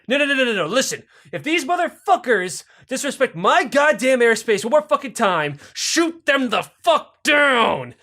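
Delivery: high, loud order, stretched at the end; enraged Castro. Acted. Enraged Castro ordering spy planes shot down, acted fury.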